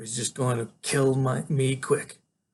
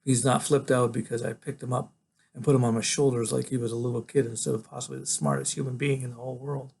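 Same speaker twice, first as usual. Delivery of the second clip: ordinary delivery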